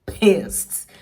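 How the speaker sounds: really annoyed, hit pissed hard; loud pissed. Real, not acted. Angry at the rumour, loud pissed. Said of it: "now I'm pissed".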